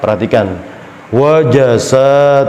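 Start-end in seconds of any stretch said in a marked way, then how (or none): 1.1-2.4 WAJASA with heavy rounded vowels; wrongly thick. Shown as a demonstration. Teacher showing what is not allowed, thick Wajasa. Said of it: "tidak boleh".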